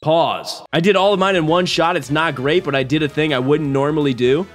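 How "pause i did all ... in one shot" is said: ordinary talk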